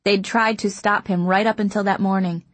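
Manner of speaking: usual speaking voice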